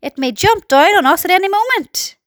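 high, urgent order; police chief calling out in alarm; yelled warning. A narrator's reading. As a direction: warn him back before it jumps. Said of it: "yelled the chief of police".

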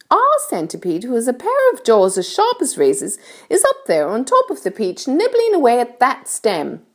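high-pitched storybook ladybird voice; kindly ladybird. A narrator's reading. Ladybird explains things to James, kindly high voice. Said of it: "continued the ladybird".

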